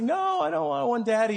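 high-pitched imitation of a balking four-year-old; protesting small child. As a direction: mimic a kid pleading to get out of it. Voicing four-year-old refusing the ride.

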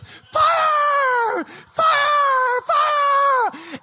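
very high, drawn-out shriek; screaming fire. Shown as a demonstration. Brother showing sister how to scream fire, piercing scream. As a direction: scream it loud and long like an alarm. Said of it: "like this".

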